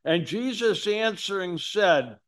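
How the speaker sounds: ordinary talk, nothing marked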